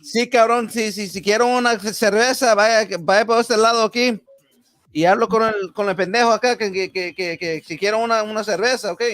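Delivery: high, rattled-off mock local Spanish; exasperated local, play-acted. Local replying to a tourist seeking beer, high mock-exasperated voice. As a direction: answer the hapless tourist in a high, pestering put-on voice.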